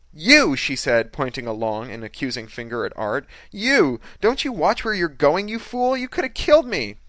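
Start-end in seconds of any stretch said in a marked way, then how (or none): 0.1-0.6 high, loud, furious outburst; accusing shout. A narrator's reading. Victim accuses the driver. 3.5-7.0 high, loud scolding of the driver; furious accusation. A narrator's reading. Victim berates the driver.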